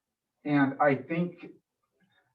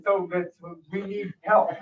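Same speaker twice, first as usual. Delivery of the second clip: talking through a real laugh, slowly; a chuckle carried through the words; 6 semitones higher than usual. Real, not acted. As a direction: laugh it off mid-sentence. Laughs after awkward aside.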